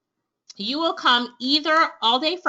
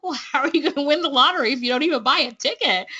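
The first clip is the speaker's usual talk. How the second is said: fast, amused disbelief with a laugh; incredulous laughing retort; much faster than usual. Retold or imitated. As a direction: laugh it off like the answer is obvious. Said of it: "I'm like".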